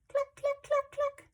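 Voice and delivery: very high staccato clucks; clucky chicken. Acted. Reader shows children a chicken's hello, high clucking. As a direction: cluck it squeaky like a flustered hen. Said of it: "is flappy and clucky".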